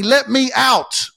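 high, loud, slow "Let me out"; soul pleading. Pretend. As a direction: drag it out like a final plea. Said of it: "it's calling you and it's saying".

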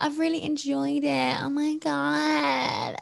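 hammed-up gushing, very slow and high; mock the fake gush, stretched out; fake sincerity. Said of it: "I sounded really fake".

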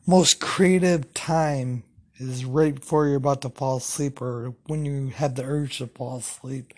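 ordinary talk, nothing marked

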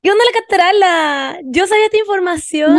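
high and loud, bursting with news; can't hold in the excitement; squealing with gossip; excited. Real, not acted. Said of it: "me emocioné".

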